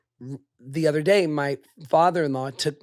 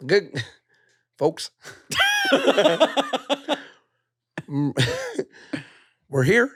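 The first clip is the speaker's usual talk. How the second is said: laughing Obama impression with scratchy voice; 3 semitones higher, 3 dB louder, slower than usual. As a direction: try Obama through the giggles. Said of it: "do my Obama with my scratchy voice".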